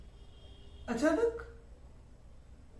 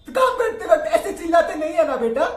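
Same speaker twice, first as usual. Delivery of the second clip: loud, fast scolding yell; angry shout; 5 semitones higher, 11 dB louder, faster than usual. Acted. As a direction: yell at the child for crying. Father shouting at child. (बच्चे पर गुस्से से चिल्लाना)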